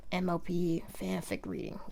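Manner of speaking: whispered aside. Real, not acted. Vlogger whispering the video title to viewers. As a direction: breathe the words out.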